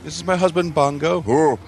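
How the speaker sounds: lightly mock a wife presenting her husband; mock wife introducing husband.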